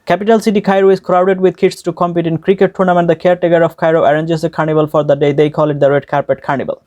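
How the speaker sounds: connected tongue-twister run without pauses; all run together. Shown as a demonstration. Teacher shows the whole thing at once, run together. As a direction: roll straight through in one even stream. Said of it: "এবার সম্পূর্ণটা একসাথে শুনুন".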